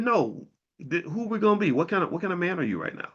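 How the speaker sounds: high, fast, fired-up self-challenge; pep talk to himself. Retold or imitated. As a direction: psych yourself up to get out of bed. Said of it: "I said".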